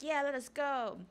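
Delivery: put-on high-pitched eager child cry; cheering kids. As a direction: pipe up like a kid rallying. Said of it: "the kids are like".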